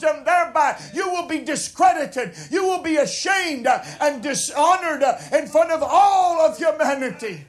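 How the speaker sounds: rising to a loud, high preaching climax, shouting at the end; fervent preaching. Real, not acted. Preacher warning the congregation of judgment, rising to a shout. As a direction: build the warning up like a revival preacher until you are shouting.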